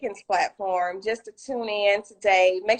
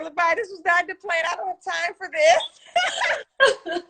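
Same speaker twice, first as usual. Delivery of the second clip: mock tearful protest; 7 semitones higher than usual. Retelling being moved to tears by a friend, mock tearful protest. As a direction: retell a tearful complaint, half laughing. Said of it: "she had me in tears".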